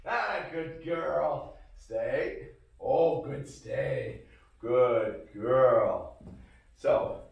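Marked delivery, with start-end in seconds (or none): 0.1-6.2 slow, soothing praise; calm praise. Shown as a demonstration.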